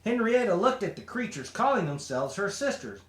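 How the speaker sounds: usual speaking voice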